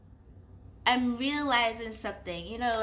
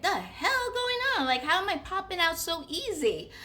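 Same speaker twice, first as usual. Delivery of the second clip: alarmed; 9 semitones higher than usual. Retold or imitated. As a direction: shout it in disbelief. Alarmed at popping out again.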